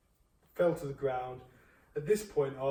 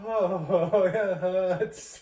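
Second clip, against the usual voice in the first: loud, drawn-out mock whimper; hammed-up agony with a laugh; mock pained moan; 3 semitones higher, 7 dB louder, much slower than usual. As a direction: imitate a hurt friend on the floor.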